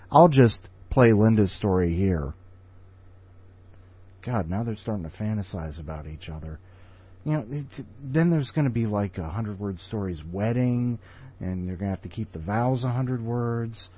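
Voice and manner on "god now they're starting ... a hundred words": quiet aside. Real, not acted. Muttered aside after the story.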